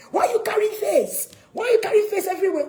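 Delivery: high, loud scolding shout; acted furious outburst; angry parent. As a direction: yell at a sulky teenage daughter. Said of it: "You get angry that she's gloomy".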